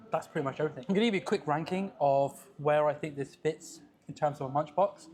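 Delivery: normal talking voice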